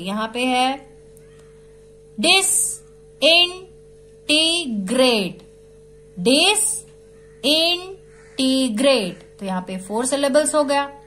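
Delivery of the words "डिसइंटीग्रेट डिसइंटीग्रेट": Disintegrate in four slow beats with clear gaps; disintegrate chopped into four drawn-out syllables. Shown as a demonstration. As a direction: sound out disintegrate in four separate bits. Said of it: "four bits में हम इसको पर".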